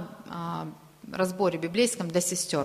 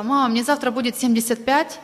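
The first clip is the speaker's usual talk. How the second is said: put-on higher, louder child's exclaim; a retold girl's eager cry to her mother; voicing the girl; 5 semitones higher, 7 dB louder than usual. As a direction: pipe up like an excited little girl telling her mum. Girl tells mother in story.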